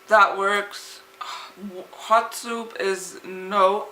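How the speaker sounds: ordinary talk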